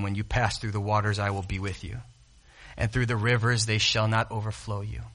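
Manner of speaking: very soft, low scripture reading; prayerful reading. Acted. Preacher reading Isaiah to the church, hushed. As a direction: read it softly as a prayer.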